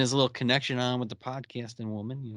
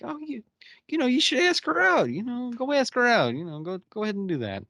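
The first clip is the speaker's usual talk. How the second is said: eager egging on; 11 semitones higher, 3 dB louder than usual. Retold or imitated. Group urging Charles to ask.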